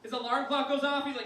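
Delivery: usual speaking voice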